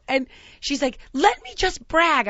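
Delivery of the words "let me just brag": loud, high, drawn-out mom plea; slow, pushy mom voice; bragging mom. Retold or imitated. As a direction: imitate a mother insisting on boasting.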